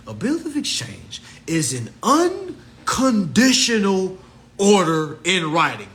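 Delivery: slow deliberate reading, each word weighed; slow weighty recitation; slow emphatic read. Shown as a demonstration. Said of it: "Really hear what's about to be said".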